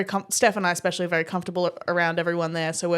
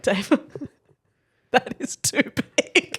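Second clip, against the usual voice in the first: laughing disbelief; 4 semitones higher, slower than usual. Retold or imitated. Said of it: "we were like".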